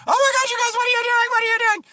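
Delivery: very high squealed mock shock; shocked little boy. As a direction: squeal it like a horrified kid. Child walking in on parents.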